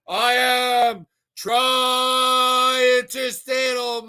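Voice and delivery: very slow, stretched loud shout; drawn-out catchphrase shout. Acted. Host greeting a viewer with his catchphrase, stretched shout. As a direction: stretch every syllable like a ring announcer.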